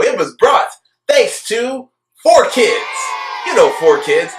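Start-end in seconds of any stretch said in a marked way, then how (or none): 0.0-2.8 sarcastic shout. Real, not acted. Sarcastic shout about dub company.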